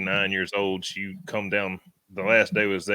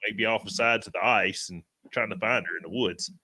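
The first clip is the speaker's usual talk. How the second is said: ordinary talk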